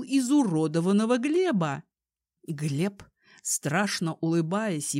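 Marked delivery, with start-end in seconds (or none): none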